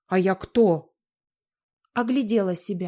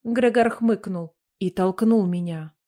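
ordinary delivery

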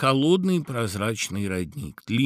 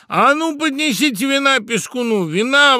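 very high, loud toastmaster's order; booming festive command; loud exclamation; 8 dB louder than usual. A narrator's reading. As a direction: call for wine at full voice. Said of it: "воскликнул Тамада".

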